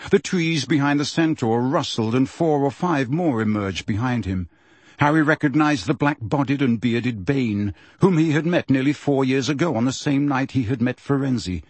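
ordinary delivery, nothing marked

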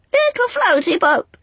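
very high, loud screech; screeching birds. A narrator's reading. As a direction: screech it like seabirds. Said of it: "screeched the angels".

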